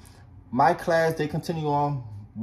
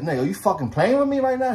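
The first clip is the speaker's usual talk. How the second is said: higher-pitched mock indignation; retold getting mad; 6 semitones higher than usual. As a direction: challenge him, mildly angry, as a retold quote. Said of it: "He started getting mad".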